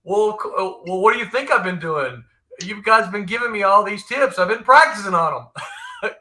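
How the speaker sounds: acted-out indignant reply in his voice; Tommy. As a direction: imitate your friend protesting, voice pushed up. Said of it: "you gotta hear this in his voice".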